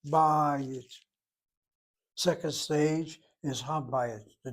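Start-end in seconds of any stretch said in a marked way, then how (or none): 0.1-0.8 first syllable of Bayit stretched long and louder; accent drawn out. Shown as a demonstration. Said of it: "draws the accent".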